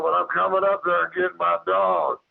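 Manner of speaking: high-pitched, insistent retold warning. Retold warning to creature in tree.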